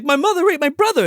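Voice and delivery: very high, loud exclamation; hammed-up teenage panic; mock distraught cry. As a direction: blurt the awful news in mock horror. Acting teens' shocked confessions.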